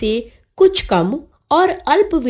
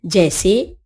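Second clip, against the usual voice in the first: usual speaking voice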